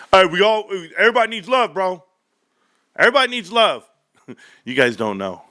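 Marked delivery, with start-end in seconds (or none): 0.1-3.9 high, pleading everybody-needs-love voice; brother's voice. Retold or imitated. Brother defending his dating life, high and pleading. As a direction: plead it up high like a lovesick bro. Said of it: "Reek's right there".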